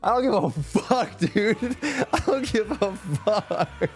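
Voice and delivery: high, laughing protest; laughing exasperation. Real, not acted. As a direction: let laughter break up the swearing. Laughing at his own retold retort.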